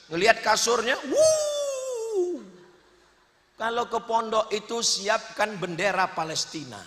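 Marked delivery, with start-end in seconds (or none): 1.1-2.4 long high crying "wooo"; hammed-up crybaby howl; mock wail. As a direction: wail high on one held note, in jest. Siap-siap mau nangis.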